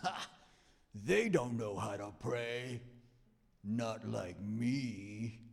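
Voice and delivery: slow, smug put-on voice of a proud believer; mock smug believer. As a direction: drawl the boast like a self-satisfied saint. Mocking a self-righteous believer.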